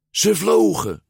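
high, exhilarated burst; thrilled narration. A narrator's reading. Narrator at the top of the jump, thrilled. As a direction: lift it like take-off.

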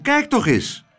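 urgent high-pitched call; excited high cry. A narrator's reading. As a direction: call out in alarm to look. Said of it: "riep Klaterhoen".

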